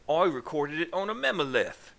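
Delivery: a light put-on Australian accent; half-hearted Australian accent. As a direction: try for an Australian twang, not too well. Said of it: "the best Australian accent I could possibly give".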